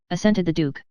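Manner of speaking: ordinary delivery, nothing marked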